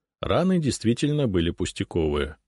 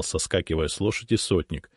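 plain reading voice

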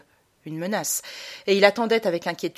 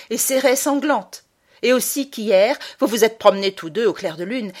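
loud, higher cutting mockery; sting her with every word; biting sarcasm; 4 semitones higher, 6 dB louder than usual. Boy sarcastically defying mother, biting. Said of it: "cinglant, mordant, méchant".

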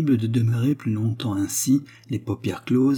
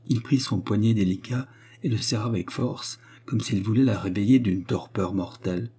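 usual speaking voice

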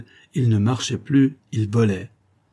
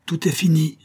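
higher-pitched cry of despair; exclaiming; 7 semitones higher than usual. A narrator's reading. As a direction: exclaim it as all is lost. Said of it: "s'écria Elim". (s'écrier, voix haute)

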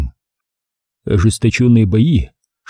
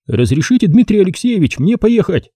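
put-on raised, higher voice for the young captain; polite request, pitched higher; Savelyev's voice; 8 semitones higher than usual. A narrator's reading. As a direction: lift into the eager captain.

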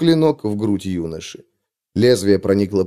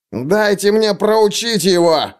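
slow, higher growled demand; рычит, вырываясь; 8 semitones higher, slower than usual. A narrator's reading. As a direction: snarl it, straining to break free. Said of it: "прорычал он".

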